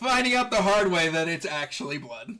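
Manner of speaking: loud, laughing through the words; game master losing it laughing; amused laughing. Real, not acted. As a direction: say it while cracking up. Game master amused by player's action.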